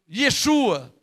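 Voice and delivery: ordinary delivery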